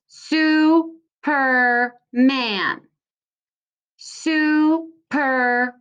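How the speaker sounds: Superman drawn out slowly in three parts; slow, split Superman; syllable by syllable. Shown as a demonstration. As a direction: pull Superman apart slowly. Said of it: "So there's three parts".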